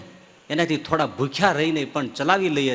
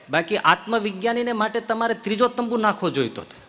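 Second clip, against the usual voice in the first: high, puffed-up self-praise; boastful sage; 6 semitones higher than usual. Acted. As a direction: proclaim your own greatness grandly. Self-proud sage boasts to king. (ઊંચા, ઘમંડી અવાજે પોતાની મોટાઈ કહેતો જ્ઞાની)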